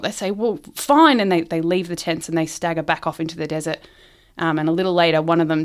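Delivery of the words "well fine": higher, louder exasperated giving-up; hammed-up defeat, pushed out loud; mock distraught. Thirsty men giving up on the tents, mock distraught. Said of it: "super distraught".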